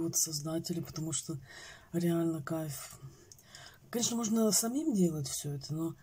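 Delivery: eating yogurt while talking; talking with spoon at lips. Real, not acted. Seen 3: Spoon of yogurt held against lips while she talks, tasting it.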